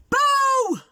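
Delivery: a sudden, very high, loud boo; shout. Acted. Tiny Big Bro shouts boo at the big animals. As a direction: shout it to make them jump. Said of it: "'cause we're gonna shout it".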